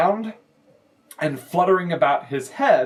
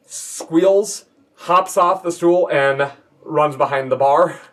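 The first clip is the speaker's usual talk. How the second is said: usual speaking voice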